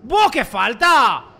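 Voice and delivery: loud, drawn-out complaint; outraged shout. Real, not acted. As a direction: protest the foul. Reacting to foul. (queja a gritos)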